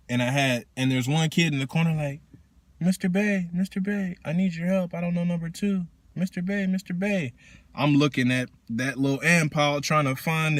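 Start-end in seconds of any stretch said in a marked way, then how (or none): 2.8-7.3 put-on higher, timid child calling for the teacher; small kid calling. Kid in corner voiced.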